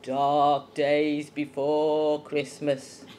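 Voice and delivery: put-on low, very slow, drawn-out old saying; granddad's voice. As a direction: intone it low, stretching every word like granddad. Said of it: "he used to say it in this voice".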